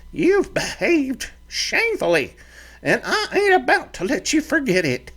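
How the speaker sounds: put-on high, fiery old ranch mother; stern mother's threat, pitched high; scolding mother. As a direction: scold your grown boy like a fiery mama.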